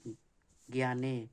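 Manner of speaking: ज्ञाने stretched out very slowly for the class; teacher drawing out ज्ञाने; drawn-out word. Shown as a demonstration. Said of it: "तो ज्ञ को तोड़ लेंगे".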